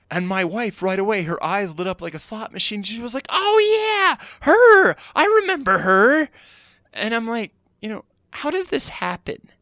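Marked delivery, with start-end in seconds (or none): 3.3-6.3 much higher and louder, sudden delighted realisation; excited recognition. Retold or imitated. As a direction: squeal it as the memory clicks. Wife suddenly remembering, excited.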